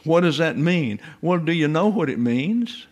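ordinary talk

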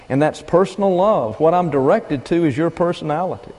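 normal talking voice

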